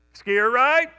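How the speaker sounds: loud high shout down the slope; calling out. Retold or imitated. Skier calling out again.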